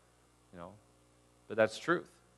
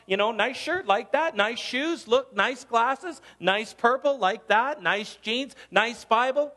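high, loud, gushing flattery; over-the-top cheerful praise; gushing compliments; 10 semitones higher, 6 dB louder, slower than usual. Retold or imitated. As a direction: rattle off compliments like an eager churchgoer. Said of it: "run around the church going".

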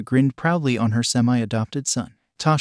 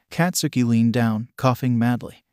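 ordinary delivery

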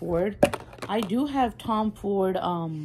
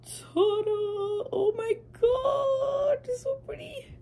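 much higher, squealing ta-da into a shriek; ecstatic squeal; slower than usual. Real, not acted. Vlogger squealing at her favorite palette reveal. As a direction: squeal like the palette just stole your heart. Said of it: "I am going to scream".

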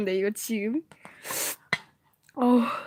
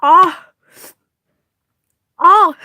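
pained cry; 5 semitones higher, 12 dB louder, much slower than usual. Real, not acted. Pain cries from spice.

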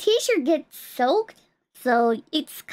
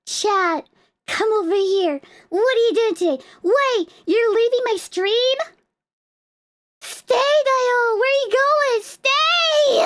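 high, needy whine building to a wail; mock pleading; 6 semitones higher than usual. Streamer begging chat not to leave the stream, pleading. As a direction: beg them to stay, more desperately each time.

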